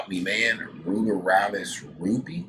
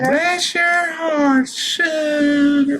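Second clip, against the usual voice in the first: very high, slow, louder Southern drawl; Southern accent; 8 dB louder, much slower than usual. Acted. Southern lady voice blessing the viewers, slow drawl. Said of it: "That good old Southern sounding voice".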